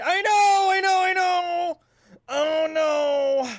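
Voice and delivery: high, drawn-out cry of distress; panicked wail. Real, not acted. Shop owner fumbles prices under pressure, panicked wail. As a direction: wail it high, stretching the vowels.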